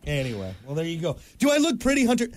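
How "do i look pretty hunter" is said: mock vain patient. Mocking botched cosmetic surgery.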